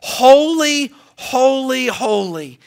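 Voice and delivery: high ringing call of holy; exultant heavenly cry; angels crying out. Acted. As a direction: cry it out like the seraphim. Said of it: "they cried out".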